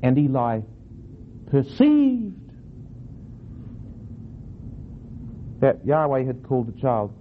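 big swooping emphasis on "perceived", then a long pause; stress on PERCEIVED. Shown as a demonstration. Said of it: "different ways of emphasizing that verse".

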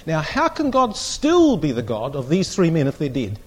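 ordinary delivery

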